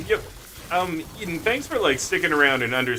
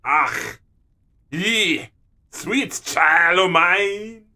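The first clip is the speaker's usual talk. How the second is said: loud, drawn-out mock Scottish brogue; exaggerated Scottish impression with long vowels; Scottish accent; 5 semitones higher, 4 dB louder, much slower than usual. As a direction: put on a big Scottish burr. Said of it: "it's their Scottish accent".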